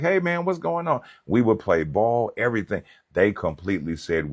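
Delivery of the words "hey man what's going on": cheerful greeting. Retold or imitated. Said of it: "I'm like".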